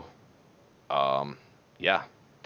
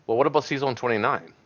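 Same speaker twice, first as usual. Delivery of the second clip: ordinary talk, nothing marked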